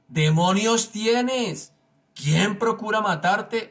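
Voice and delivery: high, slow, drawn-out outrage; feigned indignation. Acted. Crowd answering Jesus, pretending to be indignant. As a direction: act out a crowd's hammed-up indignation. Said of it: "con toda la indignación de la que eran capaces".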